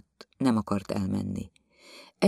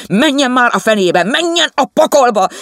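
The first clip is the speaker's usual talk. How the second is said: much higher and louder furious yell; 14 semitones higher, 12 dB louder than usual. Acted. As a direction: bellow him away. The husband yells in the quarrel. (dühös kiabálás, sokkal magasabban és hangosabban)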